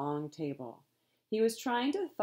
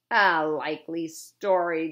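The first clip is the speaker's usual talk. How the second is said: slow sceptical growl, louder; 10 dB louder than usual. A narrator's reading. Captain scoffs at the butler's story, slow and gruff. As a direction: scoff at the excuse. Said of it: "growled the captain".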